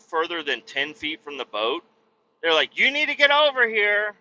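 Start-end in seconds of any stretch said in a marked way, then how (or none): 2.8-4.2 high, louder bossy call; high mocking summons; mock nagging call. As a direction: call him in like a worried mom. In-laws calling him back.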